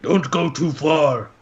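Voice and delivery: slow, lightly put-on character voice; Lucian. Companion cautions Shiro leaving the group, slow character voice. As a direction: draw out a warning in a light character voice. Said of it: "I'm trying to be his voice".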